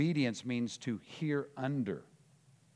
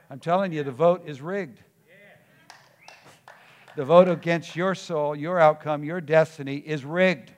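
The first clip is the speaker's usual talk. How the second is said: loud, higher-pitched preaching with heavy punches; full-force preaching; 5 semitones higher, 10 dB louder than usual. Real, not acted. Preacher telling believers the vote against them is rigged, forceful preaching. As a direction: drive every phrase home at full volume.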